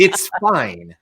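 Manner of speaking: coaxing. Retold or imitated. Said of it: "I'm, like, playing to it".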